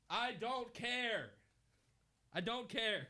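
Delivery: high, slow, drawn-out "I don't care"; sing-song dismissal; teasing the story with a high slow brush-off; mock high "I don't care". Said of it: "Three words, summarize this story".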